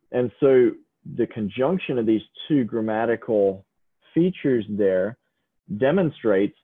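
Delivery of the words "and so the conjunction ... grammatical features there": ordinary delivery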